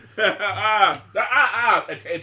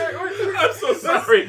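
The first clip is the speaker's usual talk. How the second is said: slow, higher, laughing I'm so sorry; laughing apology; 7 semitones higher, slower than usual. Real, not acted. After farting, apologizes to friends through laughter. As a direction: apologize while cracking up. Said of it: "I'm laughing so much".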